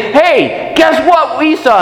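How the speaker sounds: high, eager burst of news; excited disciples. Acted. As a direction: blurt it out breathless with joy. Disciples rushing back with news.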